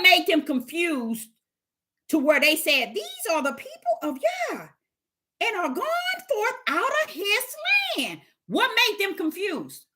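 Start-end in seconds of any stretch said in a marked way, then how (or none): 2.9-8.2 higher, lightly voiced outsider; a hint of the awed heathen; heathen quote. Quoting heathens again, acted voice.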